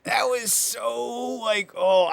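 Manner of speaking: delighted disbelief, stretching the words; excited. Real, not acted. A co-host reacting to a soap opera moment, excited and high. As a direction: pitch jumps up on a drawn-out so.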